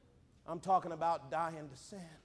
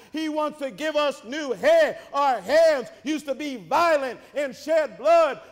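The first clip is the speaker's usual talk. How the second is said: rhythmic sermon climbing from even to loud; building preaching; 4 semitones higher, 7 dB louder than usual. Acted. Preacher lists what Christ wants for us, building preaching. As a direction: stack each phrase higher and stronger.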